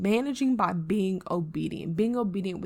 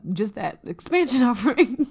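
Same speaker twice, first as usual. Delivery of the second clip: laughing through it; 3 semitones higher, 7 dB louder than usual. Real, not acted. Laughing at own fumbling for words.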